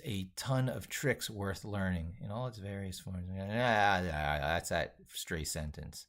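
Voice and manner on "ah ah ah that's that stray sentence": usual speaking voice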